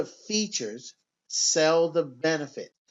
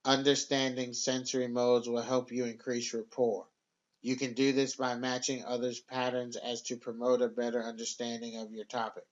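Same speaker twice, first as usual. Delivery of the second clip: flat, lower monotone read; 4 semitones lower, 4 dB quieter than usual. Shown as a demonstration. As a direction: drone it with no melody. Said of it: "Monotone sounds like this".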